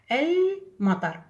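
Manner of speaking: المطر drawn out very slowly as elmatar; slow careful model. Shown as a demonstration. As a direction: spell out المطر syllable by syllable. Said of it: "ما نقول أمطر".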